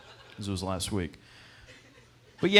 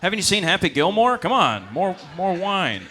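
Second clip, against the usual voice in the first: put-on kid's pickup line; 6 semitones higher, 4 dB louder, slower than usual.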